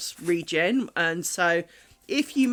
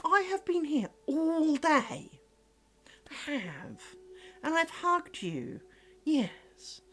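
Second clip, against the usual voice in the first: cooing to a pet; 6 semitones higher, 7 dB quieter, slower than usual. Real, not acted. Cooing fondly to her cat.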